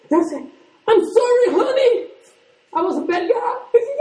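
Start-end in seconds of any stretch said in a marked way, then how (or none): 0.9-3.5 high, sweet mock apology; hammed-up sorry to his wife. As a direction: grovel to her in a high sugary voice.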